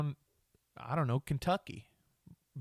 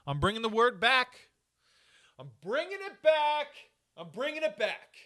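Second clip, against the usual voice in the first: much higher and louder, rallying cry; fired-up proclamation; 9 semitones higher, 5 dB louder than usual. Real, not acted. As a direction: announce it like a crusade, pitch way up. Rallying listeners to revive a word.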